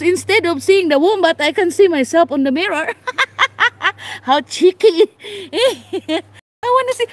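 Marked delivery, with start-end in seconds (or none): 3.0-4.2 laughing. Real, not acted. Sees own reflection instead of wombat. 4.2-7.1 real laugh running through the words; giggling at herself in the glass. Real, not acted. To her reflection in the wombat enclosure glass, giggling.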